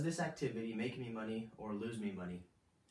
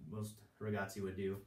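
ordinary delivery

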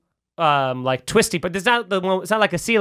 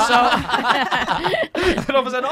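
usual speaking voice

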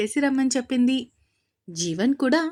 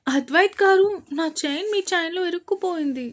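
shouting; 8 semitones higher than usual. Acted. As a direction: yell out in a panic. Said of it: "అంటూ అరుస్తూ ఉంది అశ్వి".

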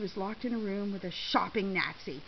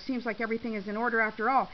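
usual speaking voice

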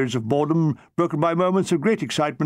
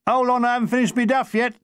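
Cockney heckle; 6 semitones higher than usual. Acted. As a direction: shout it like a Cockney gunner. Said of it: "Cockney witticisms".

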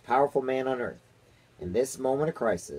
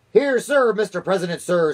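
high, loud falsetto reply; 10 semitones higher, 9 dB louder than usual. A narrator's reading. Chief spy reporting to the president, falsetto voice. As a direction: answer up in a falsetto, loudly. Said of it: "and a falsetto voice".

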